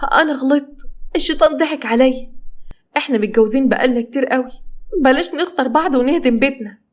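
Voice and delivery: high, remorseful pleading; pleading confession. Acted. Wife admitting fault to husband to save home, pleading.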